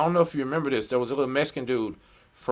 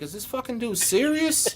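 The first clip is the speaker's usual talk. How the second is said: incredulous; 10 semitones higher than usual. Retold or imitated. Disbelief to cellmate.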